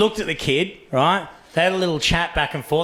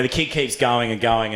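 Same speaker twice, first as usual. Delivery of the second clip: normal talking voice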